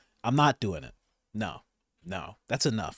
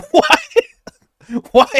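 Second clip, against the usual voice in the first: shrieking laugh of disbelief, much higher and louder; 6 dB louder than usual. Real, not acted. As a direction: burst out laughing on the word.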